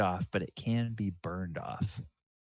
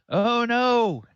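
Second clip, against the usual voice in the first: loud, high-pitched cry of alarm; hammed-up alarmed call; 9 dB louder than usual. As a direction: shout it out in character, much higher than usual. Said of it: "is able to call out".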